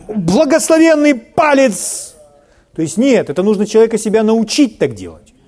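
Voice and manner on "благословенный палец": slow, high-pitched mock exclamation; mock outcry. Preacher acts out someone who hit a finger, mock outcry. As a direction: cry it out high, stretching the words. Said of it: "он сразу не кричит".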